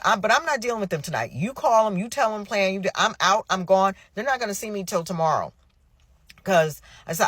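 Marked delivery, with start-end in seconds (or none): 0.0-5.5 retold exasperated ultimatum.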